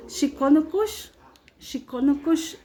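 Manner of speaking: "Shikonokush" drawn out slow with a lazy rise; acting bored. Shown as a demonstration. Teacher mimics bored students saying "Shikonokush". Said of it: "muchas veces están ustedes así".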